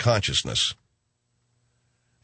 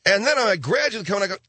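ordinary talk

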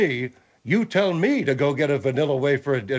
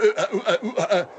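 higher mocking cartoon noises; cartoon stammer imitation; 5 semitones higher than usual. Co-host mocks Sadler's noises, cartoon imitation. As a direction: mimic his stutter as cartoon babble. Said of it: "you sounded like something out of a Hanna-Barbera".

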